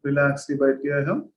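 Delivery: slow, measured meditation guide; guided meditation. Real, not acted. Teacher guiding students to sit at ease with the breath, slow meditation voice. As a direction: lead them into stillness, drawing out words between long silences.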